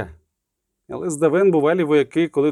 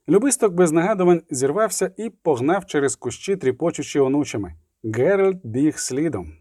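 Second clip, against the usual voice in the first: plain reading voice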